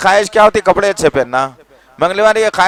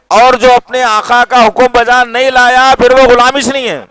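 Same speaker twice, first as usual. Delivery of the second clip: fiery preaching; 6 semitones higher, 6 dB louder than usual. Real, not acted. Preaching climax.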